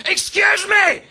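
high, loud Northern Irish yell; Northern Irish heckler. Retold or imitated. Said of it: "in that understated Northern Irish accent".

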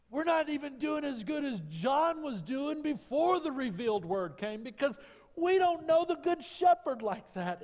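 high, loud climactic preaching cry; full-throated revival preaching; preaching at full force. Acted. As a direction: lift it to a soaring shout from the pulpit.

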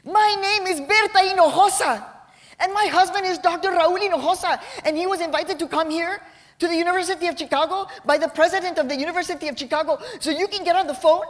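a small woman drawing herself up, pleading hard; mom's panicked high voice. Retold or imitated. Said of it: "her voice and her kitten heels on her tiptoes saying".

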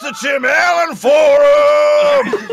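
slow, high, shouted proclamation; mock announcer shout. Friend bursts in with a slow announcer shout. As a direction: boom it out like a game show host.

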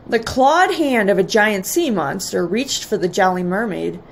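usual speaking voice

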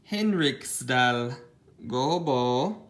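lightly put-on Scandinavian-sounding accent on Henriksdal Gobo; slow Swedish-style accent; mild mock Swedish accent; odd foreign accent. Real, not acted. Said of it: "Don't know why I said it with that accent".

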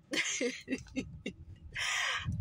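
wheezing, voiceless laughter; real wheezy laugh; breathy laugh. Real, not acted. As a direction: laugh softly until it turns to air. Laughs at the literal reading.

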